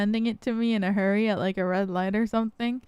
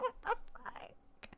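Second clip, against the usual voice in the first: slow, voiceless disbelief; stunned whisper; 11 semitones higher, 17 dB quieter, slower than usual. Retold or imitated. Daughter hearing mom tried filming the fire, stunned whisper. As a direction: whisper it, far softer, as if speechless.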